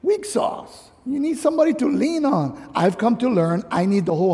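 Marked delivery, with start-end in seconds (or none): 0.0-2.6 mocking scoff. Young Kalihi kid mocking Christians, dismissive scoff. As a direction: mimic a young cynic scoffing. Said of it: "I just".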